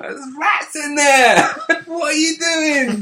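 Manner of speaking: high, slow, laughing London shout; loud shocked yell. Retold or imitated. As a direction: yell in disbelief at rats. Family shouting at rats.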